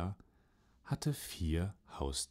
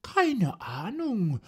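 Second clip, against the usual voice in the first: hoch, langsam und gleichgültig; Katze; 8 dB louder, slower than usual. Acted. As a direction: gedehnt wie eine gelangweilte Katze. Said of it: "sagte die Katze". (Katze, hoch und gedehnt)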